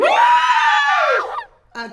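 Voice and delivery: mock scream. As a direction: scream out the words as if startled. Acting the scream in story.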